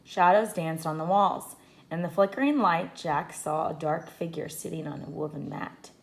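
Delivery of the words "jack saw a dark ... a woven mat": ordinary delivery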